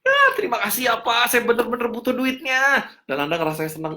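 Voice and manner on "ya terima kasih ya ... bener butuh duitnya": happy gratitude. Acted. Recipient thanking the man who gave money, happy and high-pitched. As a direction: play a thrilled receiver pouring out thanks. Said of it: "orangnya happy".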